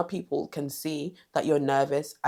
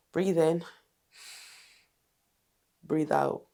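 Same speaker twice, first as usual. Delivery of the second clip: calm breathing cue; slower than usual. Shown as a demonstration. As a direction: guide the breath gently with long pauses. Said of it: "I will really practice breathing".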